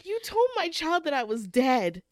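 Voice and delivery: high, loud indignant outburst; mock scandalized yell at the character; hammed-up outrage; outraged. Retells her own outraged reaction.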